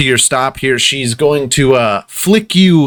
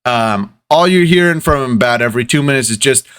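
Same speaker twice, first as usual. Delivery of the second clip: ordinary talk, nothing marked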